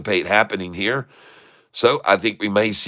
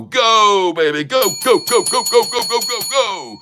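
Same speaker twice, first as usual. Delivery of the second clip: high, loud chant of go; excited cheering; 10 semitones higher, 3 dB louder than usual. Real, not acted. Trader cheering his stock higher, high excited chant.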